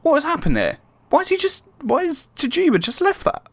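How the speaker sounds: high, baffled what-just-happened; watching his player drop the ball, in shocked disbelief; stunned disbelief. Real, not acted. Shocked at a teammate's mistake.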